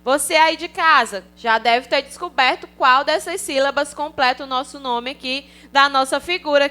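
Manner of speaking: normal talking voice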